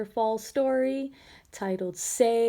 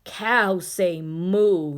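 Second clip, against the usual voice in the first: very slow, louder cow; 3 semitones lower, 5 dB louder, much slower than usual. Acted. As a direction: drag it out like a cow. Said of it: "he said".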